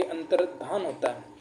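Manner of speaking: अंतर्धान drawn out slowly and softly; slow, soft dictation. Shown as a demonstration. Teacher slowly dictating अंतर्धान to students, quiet and slow. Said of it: "क्या होता है साथियों आपके?".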